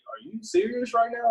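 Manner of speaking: incredulous question, pitched high; mildly acted shock at dad; retold disbelief.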